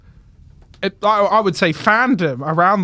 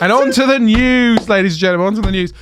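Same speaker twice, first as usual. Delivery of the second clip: news announcer; 3 semitones higher, 4 dB louder, faster than usual. Acted.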